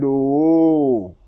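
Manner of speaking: mimicked congregation reaction, one long "oh"; crowd's drawn-out "ohh". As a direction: stretch out a loud collective "oooh". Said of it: "So everybody now said".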